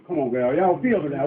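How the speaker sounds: ordinary talk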